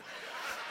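short unvoiced chuckle; breathy laugh. Real, not acted. Preacher laughing at his own joke, soft chuckle. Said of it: "trying to lighten it up".

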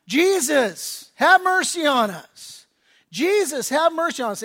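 loud, slow pleading cry of "Jesus, have mercy on us"; retold screaming. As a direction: call out loudly, begging like the blind men. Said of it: "they're screaming out".

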